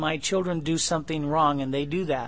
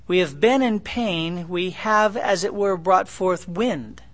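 scripture reading; 3 semitones higher, 4 dB louder than usual. Acted. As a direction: proclaim the verse to the congregation.

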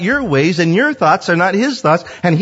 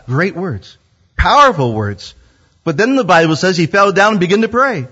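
ordinary delivery

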